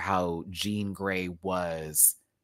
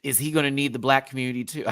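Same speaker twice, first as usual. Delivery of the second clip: ordinary delivery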